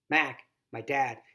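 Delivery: plain reading voice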